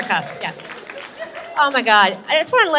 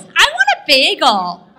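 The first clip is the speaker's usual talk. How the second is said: put-on whiny kid; 2 semitones higher, 6 dB louder, slower than usual. Kid demands a bagel.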